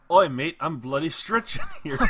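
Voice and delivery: mock Aussie accent. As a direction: joke Australian accent, bloke stretching. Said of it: "I thought you were gonna say".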